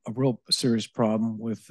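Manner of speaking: ordinary talk, nothing marked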